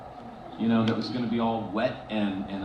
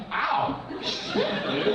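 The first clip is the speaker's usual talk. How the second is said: high mock yelp; imitated pained co-star; 13 semitones higher, much slower than usual. Co-star bitten on the first take, high mock-pained yelp. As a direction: act out a startled ow, high-pitched. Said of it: "he's like".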